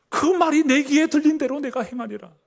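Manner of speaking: high, loud ringing verdict; thundering voice of God. Acted. Preacher echoing God's words to complainers, thundering. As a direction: hurl it out as God's warning.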